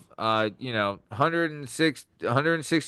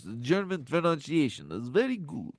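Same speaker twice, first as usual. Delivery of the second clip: softer, hammed-up Transylvanian accent; German attempt sliding into cartoon Dracula; 6 dB quieter than usual. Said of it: "went to Transylvania right there".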